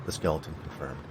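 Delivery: ordinary delivery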